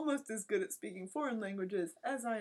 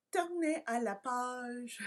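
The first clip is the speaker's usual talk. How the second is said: slow, higher drawn-out French; imitated French teacher; 4 semitones higher, slower than usual. French teacher telling class to turn pages, slow singsong imitation. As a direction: draw it out like the teacher.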